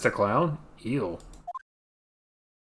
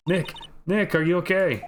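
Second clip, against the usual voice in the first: worried call; 7 semitones higher than usual. Acted.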